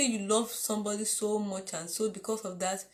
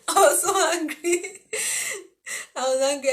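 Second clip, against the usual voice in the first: laughing indignation; 8 semitones higher, 7 dB louder than usual. Real, not acted. Recalling anger at the ex-suitor.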